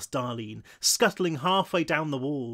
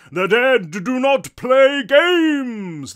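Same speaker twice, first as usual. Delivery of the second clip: slow, loud, high-pitched theatrical proclamation; booming English ghost; 9 semitones higher, 11 dB louder, much slower than usual. A narrator's reading. Offended ghost rebuking the children, booming English. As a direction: proclaim it grandly, drawing out the words. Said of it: "a deep, booming tenor with what might have been an English accent".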